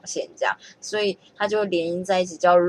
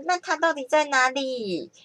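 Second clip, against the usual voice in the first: a hint of a little girl asking, higher and slow; lightly voiced little girl in a song; 8 semitones higher than usual. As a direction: ask like a curious little girl. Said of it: "那个小女生就会说".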